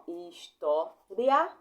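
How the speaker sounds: história drawn out slowly in three beats, "his-tó-ria"; loud, measured "his... tó... ria", rising at the end; split syllables. Shown as a demonstration. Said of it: "assim, ó".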